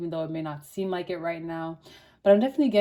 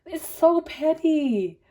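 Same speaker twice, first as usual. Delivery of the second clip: voice acting weird; 7 semitones higher, 6 dB louder than usual. Real, not acted. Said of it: "my voice is, like, acting weird".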